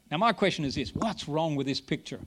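normal talking voice

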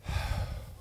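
deep sigh. Retold or imitated. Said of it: "she went".

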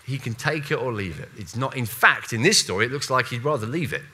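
ordinary talk, nothing marked

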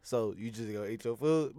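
low, soft put-on voice; imitated Lou. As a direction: drop low and quiet to quote someone. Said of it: "Lou say".

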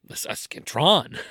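Scantron said oddly, like a robot name; funny Scantron. Real, not acted. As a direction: say "Scantron" with a strange twist. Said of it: "I like the way you said that".

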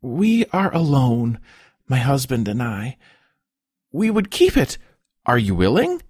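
high, hesitant plea in short halting phrases; anxious pleading. A narrator's reading. Childless wife asking to keep a peasant's boy, anxious and pleading. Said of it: "She recovered her breath and continued".

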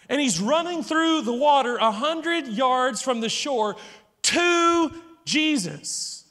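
ordinary talk, nothing marked

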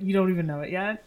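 ordinary talk, nothing marked

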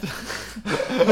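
short giddy laugh. Real, not acted. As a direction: laugh it off, amused. After trying the e-cigarette.

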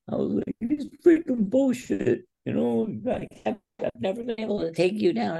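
tearful frustration. Retold or imitated. Upset wrestler protesting to his coach, high mock-tearful frustration. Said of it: "I have, like, tears in my eyes".

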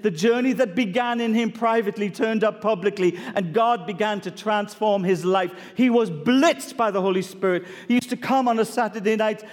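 ordinary delivery

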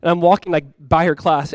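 ordinary delivery

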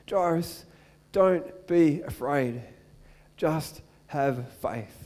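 gentle reassurance. Acted. Jesus speaking to grieving Jairus.

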